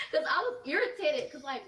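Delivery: laughing through the words; breaking into a laugh mid-sentence. Real, not acted. Laughs while explaining irritation.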